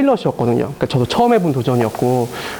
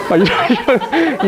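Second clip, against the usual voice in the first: laughing; 9 semitones higher, slower than usual. Real, not acted. Amused by students' wild ideas.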